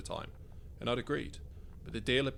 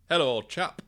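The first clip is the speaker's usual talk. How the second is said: louder, higher, hammed-up posh English; posh English accent; 6 semitones higher, 10 dB louder than usual. A narrator's reading. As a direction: greet your mate in a mock posh English accent. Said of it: "leaned hard into our respective accents".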